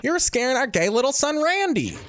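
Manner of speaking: high-pitched put-on wife voice; mock scolding mother. Mother to husband about their son, mock scolding.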